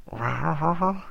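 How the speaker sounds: a playful cat asking for cake; cat noise. Acted. Cat begging the boy for his cake, mock rawr.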